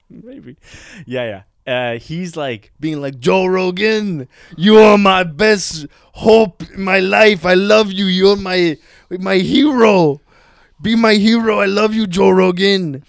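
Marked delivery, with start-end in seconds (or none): 3.2-13.0 put-on loud, high, slow French-accented superfan; gushing French accent. French fan tells Joe Rogan he is his hero, gushing mock French accent. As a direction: belt out devotion in a hammed-up French accent. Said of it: "The accent alone is pretty fun".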